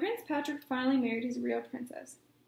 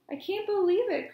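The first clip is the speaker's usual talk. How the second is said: higher, louder delighted disbelief; excited cry; 6 semitones higher, 5 dB louder than usual. A narrator's reading. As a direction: burst out in joyful disbelief. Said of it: "cried the prince".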